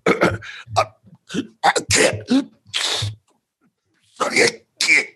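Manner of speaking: strangled, voiceless gasping for air; mock choking. Diner pranking his friend with fake fugu poisoning, choking out the words. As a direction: fake a throat seizing up, forcing words out. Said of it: "air passages and everything all seize up".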